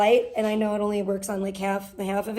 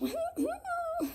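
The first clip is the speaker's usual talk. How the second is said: high squeaky laugh; 11 dB quieter than usual. Real, not acted. Struggling to open can.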